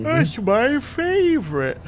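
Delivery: high, loud, drawn-out mock delight; gleeful squeal. Playful reply about favorite tea.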